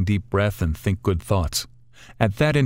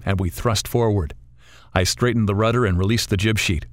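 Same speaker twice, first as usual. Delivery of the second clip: plain reading voice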